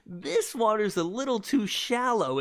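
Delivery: high laugh breaking into the words. Real, not acted. Podcaster delivering his own pool innuendo, laughing. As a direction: crack up at your own innuendo.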